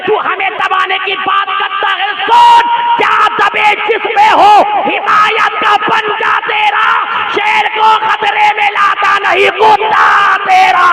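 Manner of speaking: loud, high defiant recitation; defiant couplet. Acted. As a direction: hurl the couplet back at the threat. Reciting defiant couplet at full force. (ऊँची, ललकारती आवाज़ में शेर)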